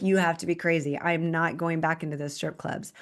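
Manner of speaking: usual speaking voice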